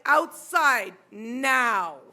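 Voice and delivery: loud, spaced-out command; stern order. Retold or imitated. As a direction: order the son out, word by word. Mother orders son out.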